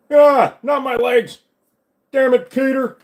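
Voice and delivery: loud, high anguished cartoon yell; Joe. Officer yelling at a friend over lost legs, hammed-up. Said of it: "do a Joe impression".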